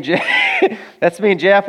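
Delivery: amused, laughing aside. Real, not acted. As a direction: point at the photo and chuckle. Laughing showing the selfie.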